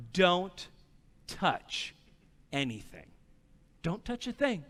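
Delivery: each word set apart by pauses, dropping low and quiet; slow, measured order to a child; stern warning. Retold or imitated. Dad warning his son before leaving, stern warning.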